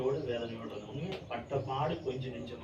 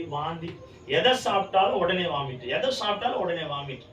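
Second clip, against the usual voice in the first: ordinary delivery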